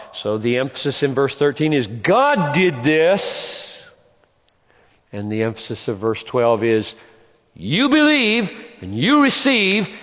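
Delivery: ordinary talk, nothing marked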